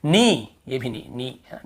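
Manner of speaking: ordinary talk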